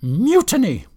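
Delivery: high, loud outraged exclamation; indignant wifely outburst; furious. A narrator's reading. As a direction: cry it out in outrage. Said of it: "she was furious".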